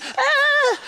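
high shrill yelp of panic; scream of fright. Retold or imitated. Retelling his mother's tears, a panicked high yelp. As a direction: yelp as the kid who thinks he's done for.

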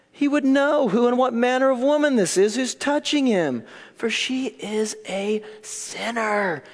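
high-pitched derision, slowing onto the last words; disdain and disgust. Acted. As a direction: sneer it with open disgust and disbelief. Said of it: "You can just hear the disdain, the disgust, the derision".

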